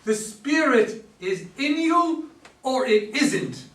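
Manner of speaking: slow, loud, emphatic correction; forceful insistence. Acted. Michael correcting Joe, slow loud insistence. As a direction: lay down the law slowly.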